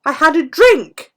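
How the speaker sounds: high, loud indignant dog voice; voicing the dog. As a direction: squeal out the dog's outrage in a put-on squeaky voice. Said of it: "She's lying there going".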